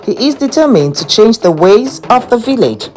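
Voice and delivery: ordinary delivery, nothing marked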